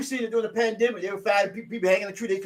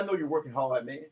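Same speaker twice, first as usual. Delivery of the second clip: voicing God; 6 semitones lower, 8 dB quieter than usual. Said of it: "God said".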